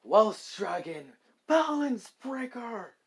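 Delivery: slow, loud, rising battle cry; booming call. Acted. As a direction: boom it out like a dragon. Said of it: "a booming voice calls out".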